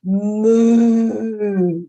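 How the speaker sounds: long, loud, drawn-out moo; big cow moo right in someone's face. Retold or imitated. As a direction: imitate a cow at full length. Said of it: "right in his face did a big".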